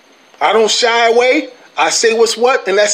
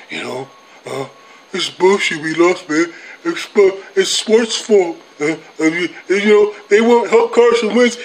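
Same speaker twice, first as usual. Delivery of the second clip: mopey Eagles fan; 3 semitones lower than usual. Retold or imitated. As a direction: sulk like a fan after a loss, dragging it. Mocking sulking Eagles fans.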